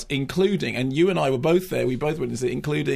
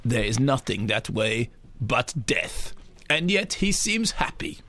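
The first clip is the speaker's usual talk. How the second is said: slow, grave German documentary narrator; deliberate, ponderous accented narration; Werner Herzog voiceover; 3 semitones lower, slower than usual. Retold or imitated. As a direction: intone it slowly, like a bleak nature film. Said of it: "to which Herzog's voiceover says".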